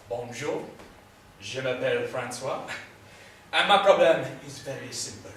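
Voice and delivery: put-on low French-accented introduction; Frenchman's accented speech. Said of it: "I think you're French".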